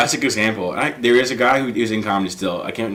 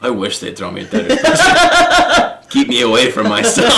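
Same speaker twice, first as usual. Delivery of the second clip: laughing quote; 11 semitones higher than usual. Retold or imitated. Buddy's text quoted.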